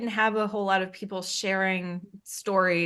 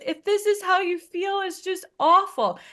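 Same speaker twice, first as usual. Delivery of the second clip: high, louder whimpering lament; whiny sister; 11 semitones higher, 5 dB louder than usual. Retold or imitated. Sister realizing how the pregnancy feels, high wailing. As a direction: play the pitiful sister.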